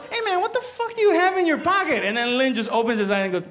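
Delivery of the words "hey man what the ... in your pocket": high-pitched mock demand; alarmed sergeant. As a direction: play the sergeant's startled question, pitched way up. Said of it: "the sergeant just goes".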